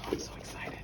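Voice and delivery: breathless whisper of delight; whispered excitement. Real, not acted. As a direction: whisper it like you can't contain yourself. Unboxing a gift to herself.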